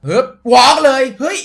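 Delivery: loud, high burst of excitement; real thrill shouting at the pack; excited shout. Real, not acted. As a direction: yell as the walkout starts. Pack walkout animation.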